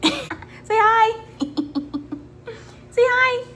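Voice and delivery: high sing-song coaxing. Real, not acted. Prompting baby to greet viewers.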